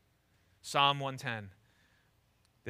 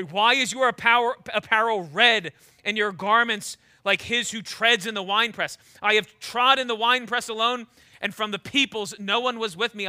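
louder, declaiming Isaiah; dramatic scripture reading; 4 semitones higher, 6 dB louder than usual. Acted. Preacher reading Isaiah to the church, declaiming. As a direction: read it out like a proclamation. Reads Isaiah sixty-three aloud.

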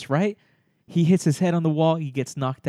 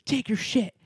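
contained high mock yell; imitated yelling; 9 semitones higher than usual. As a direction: imitate a neighbour hollering from afar. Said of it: "someone else yelling, like".

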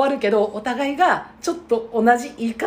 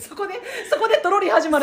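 laughing; 8 semitones higher, faster than usual. Real, not acted. Laughing at the story.

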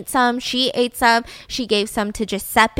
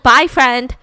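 mock farewell; 9 dB louder than usual. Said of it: "She was just like".

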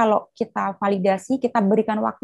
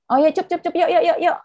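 soothing mom; 10 semitones higher, 3 dB louder than usual. Retold or imitated. Mother soothing a child.

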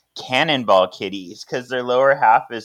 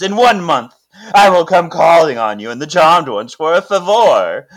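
villainous; 4 semitones higher, 7 dB louder than usual. Retold or imitated. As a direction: lay down the terms like a smug villain. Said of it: "he's so, like, villainy here".

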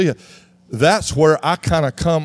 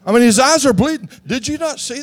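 exasperated appeal to the ref; 6 semitones higher than usual. Retold or imitated. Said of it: "don't look at me in that tone of voice".

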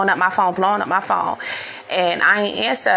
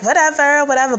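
dismissive; 6 semitones higher, 3 dB louder, faster than usual. Retold or imitated. Brushing off threat.

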